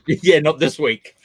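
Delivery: loud, amused, laughing reply. Real, not acted. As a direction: laugh your way into the words. Laughs at co-host's remark.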